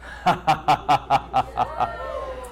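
long staccato acted laugh; God's laugh. God laughs from his throne at raging nations, acted staccato laugh. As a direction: imitate God laughing from the throne in a steady run of ha-ha-ha. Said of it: "He who sits in the heavens laughs".

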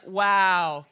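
low, slow, unimpressed "good job"; flat fake praise. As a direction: praise with no feeling at all. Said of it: "they're like".

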